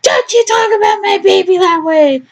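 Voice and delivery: hammed-up angry mom outburst; snapping mother. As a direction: Lorraine snapping in mock fury. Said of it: "Lorraine snaps".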